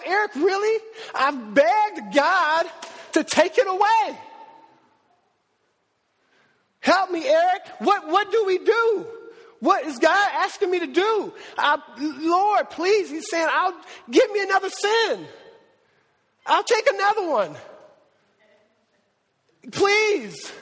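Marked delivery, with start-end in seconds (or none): 0.0-13.1 desperate pleading. Retold or imitated. Retelling friend's desperate plea. 13.5-20.5 begging plea. Retold or imitated. Friend begging God.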